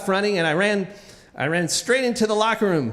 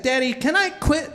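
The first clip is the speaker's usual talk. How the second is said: put-on high-pitched kid asking dad; pleading child; 7 semitones higher than usual. Kid to dad about swim team, pleading child. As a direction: imitate a child asking to get out of it.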